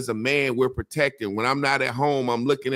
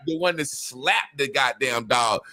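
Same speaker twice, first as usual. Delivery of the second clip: ordinary delivery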